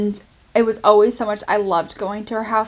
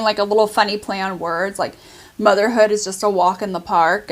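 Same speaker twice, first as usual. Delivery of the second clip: ordinary talk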